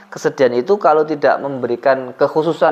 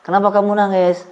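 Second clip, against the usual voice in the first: higher-pitched mock questioner; concerned questioner; 7 semitones higher than usual. Playing someone asking a weeping person, mock concern.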